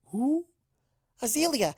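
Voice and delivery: excited. Acted. Mock owl hoot, giddy.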